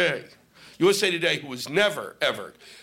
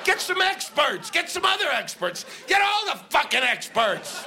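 comic fed up with the press, shouting; 10 semitones higher than usual. Acted. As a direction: yell it like you've had enough. Exasperated rant at newspaper.

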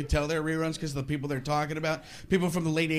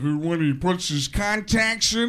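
slow, louder commentator drawl; John Madden; 3 dB louder, slower than usual. Retold or imitated. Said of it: "Madden actually said this".

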